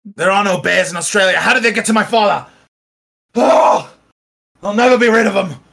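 alarmed outburst. Acted. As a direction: cry out in dread at the news.

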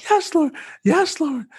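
very high, ecstatic cries; retold scream. Healed man crying out to God, ecstatic. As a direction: call out in release and joy. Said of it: "I just kept screaming".

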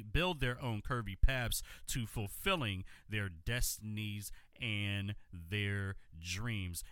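ordinary talk, nothing marked

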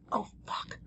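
voiceless mock oh-no moment; whispered curse. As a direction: breathe the curse out without voice. Quoted exclamation, voiceless.

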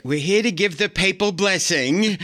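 lightly voiced theatrical actress; Ann Mira grand entrance. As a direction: sweep in like you're bestowing a blessing. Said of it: "And she's like".

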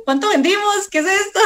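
high, hurried panicky asking; mock frantic questions. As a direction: fire off questions in a fluster. Herself frantic on phone, retold.

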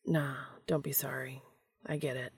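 soft reassuring whisper; gentle whispered comfort. A narrator's reading. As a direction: hush it, looking down at their hands. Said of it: "he whispered".

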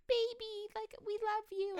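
high cooing voice to a pigeon; cooing baby talk. Retold or imitated. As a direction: coo at the bird like a baby.

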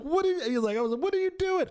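high-pitched horrified protest. Acted. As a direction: act out appalled disbelief at the date. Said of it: "And I was horrified".

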